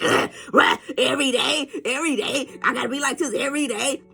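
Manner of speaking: exasperated mock complaint; fed-up mock grumble; annoyed groan. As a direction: whine about her positivity. Annoyed people mocked.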